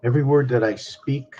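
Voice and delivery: loud spoken-word poem, pausing between phrases; poem recitation. Acted. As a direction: proclaim each phrase like a verse. Opening recited poem lines.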